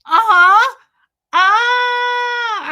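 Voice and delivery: forced grin through a cry; pretending all is fine with a stiff face; strained fake smile. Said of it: "I felt like I had a hanger in my mouth".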